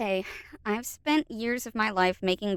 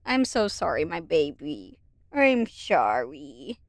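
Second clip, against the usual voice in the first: tender apology; 3 semitones lower, slower than usual. Real, not acted. Apologizing to startled cat.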